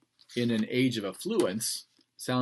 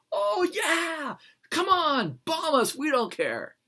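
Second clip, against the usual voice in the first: very high, loud cocky shout; cartoonish bravado daring the bombers; mock defiant Brits; 3 dB louder, slower than usual. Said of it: "You hear the difference?".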